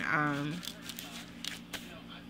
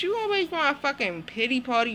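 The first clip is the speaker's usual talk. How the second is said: usual speaking voice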